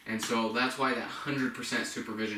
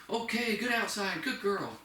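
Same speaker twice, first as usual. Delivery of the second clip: much higher, singsong puppy praise; 9 semitones higher than usual. Shown as a demonstration. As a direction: gush up high as if rewarding the dog. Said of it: "high praise".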